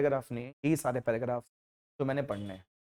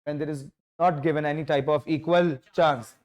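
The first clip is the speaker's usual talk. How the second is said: very slow, even definition; slow dictation; much slower than usual. Shown as a demonstration. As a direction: read it out word by word. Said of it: "बात बहुत धीरे से बोल रहा हूं". (धीरे धीरे लिखवाना)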